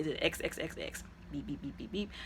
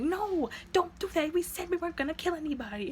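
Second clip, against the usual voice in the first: imitated protest; 11 semitones higher than usual. Nice brother protests.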